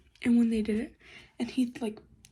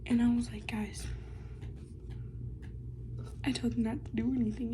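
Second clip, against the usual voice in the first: whisper; 11 dB quieter, faster than usual. Real, not acted. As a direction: whisper so nobody nearby hears. Whispering the story.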